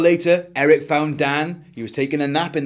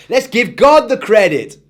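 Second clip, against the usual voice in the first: loud high excited yell; 8 semitones higher, 9 dB louder than usual. A narrator's reading. Eric yelling as he finally understands, loud and high. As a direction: burst out with it, higher and louder. Eric's voice.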